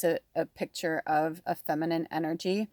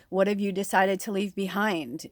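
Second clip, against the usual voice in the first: ordinary delivery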